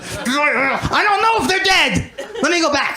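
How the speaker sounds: a worried killer who must double-check; anxious OCD killer. Pretend. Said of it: "if I killed".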